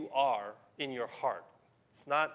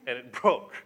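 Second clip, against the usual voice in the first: laughing; 3 semitones lower, 7 dB louder, faster than usual. Real, not acted.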